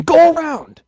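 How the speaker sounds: high, loud guard shout; guard calling out. Acted. Third guard shouting orders, high and loud. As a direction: yell the order down the alley.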